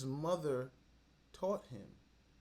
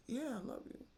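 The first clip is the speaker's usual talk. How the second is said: mock high; 11 semitones higher, 5 dB quieter than usual. Mock agreeing.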